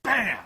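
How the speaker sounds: loud mock impact sound; bam sound effect. Mimicking the knife thudding into the counter, loud. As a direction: slam the word out like the knife landing.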